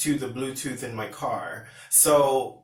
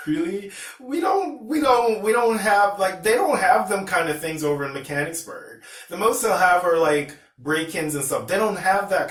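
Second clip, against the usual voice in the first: incredulous outburst; 8 semitones higher, 5 dB louder than usual. Real, not acted. Incredulous outburst about shootings.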